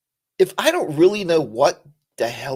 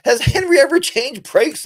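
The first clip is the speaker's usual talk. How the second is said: high mock wife's voice, a few laughs in it; wife asking whether Henry ever changed brakes, in a high mocking voice; imitating his wife; 13 semitones higher, 7 dB louder than usual. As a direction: play the wife asking, pitched well up and amused. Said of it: "Miss St. George said".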